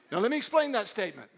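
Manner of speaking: ordinary delivery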